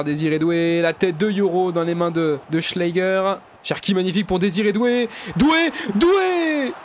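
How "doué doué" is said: excited call. Real, not acted. Player dribbles toward the goal.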